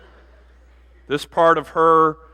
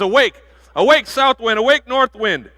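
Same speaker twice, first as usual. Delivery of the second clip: calling the winds; 9 semitones higher, 6 dB louder than usual. Acted.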